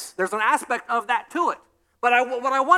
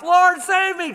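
higher, louder desperate cry; 10 semitones higher, 7 dB louder, slower than usual. Retold or imitated. Peter sinking in the storm, crying to Jesus, high and loud. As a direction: call for rescue as you sink. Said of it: "he cries up".

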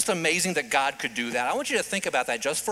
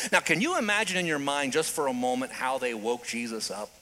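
ordinary talk, nothing marked

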